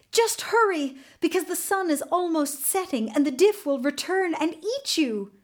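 high-pitched, emphatic begging; urgent pleading. A narrator's reading. Captive woman begs her rescuer to flee before the diff returns, pleading urgently.

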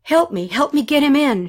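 acted urgent cry; urgent plea. A narrator's reading. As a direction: plead for help with the wounded. Urgent plea beside wounded gunner.

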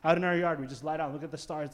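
usual speaking voice

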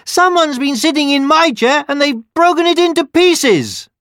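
very high, loud little bear wail; baby bear crying out. A narrator's reading. Baby Bear finds his chair broken, wailing. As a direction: squeal it as an upset bear cub. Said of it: "the baby bear cried".

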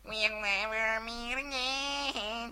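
high, slow, crying squeak; squeaky voice. Shown as a demonstration. Mocking a chart song's squeaky voice, slow and high. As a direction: squeak it out like a chart pop song. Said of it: "It was like squeaky voice".

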